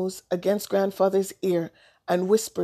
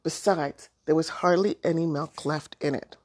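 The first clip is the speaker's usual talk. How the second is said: usual speaking voice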